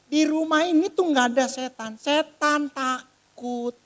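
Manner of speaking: high, emphatic, stretched at the end; imitated firm verdict. Wife reassuring the maid about devils, emphatic. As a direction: draw out the last words for effect. Wife's verdict, drawn-out ending.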